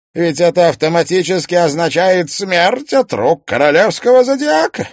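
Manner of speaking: high, loud gloating villain; theatrical villain. Acted. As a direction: relish every cruel word. Host voiced as villain.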